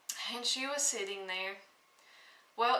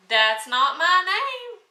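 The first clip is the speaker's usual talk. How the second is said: higher, louder gloating little man; little man's taunt; 7 semitones higher, 11 dB louder than usual. Acted. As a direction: crow it back at the queen, pleased with himself. Little man refusing each guess.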